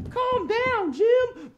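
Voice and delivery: very high falsetto echo; high echo call. Pretend.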